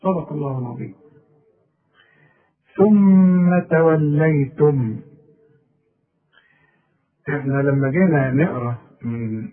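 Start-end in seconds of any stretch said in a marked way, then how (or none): 0.0-5.0 slow, chanted tajweed recitation; Quran recitation. Acted. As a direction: recite slowly with full tajweed.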